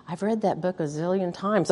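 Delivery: soft weary grumble; half-swallowed tired protest; muttered complaint. Retold or imitated. Mother tired of rereading bedtime book, soft muttered grumble. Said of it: "I was like".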